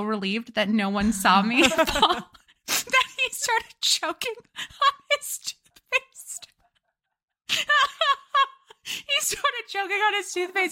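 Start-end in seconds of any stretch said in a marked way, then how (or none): 1.6-10.7 cracking up, words squeezed out high; high, slow, broken up by giggles; laughing. Real, not acted. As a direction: laugh helplessly through the words. Can't stop laughing telling the story.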